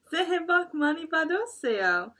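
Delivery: loud, high, fast festive chorus; New Year's wishes to the grandparents; cheerful greeting. Acted. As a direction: rattle it off joyfully. Animals' New Year greeting.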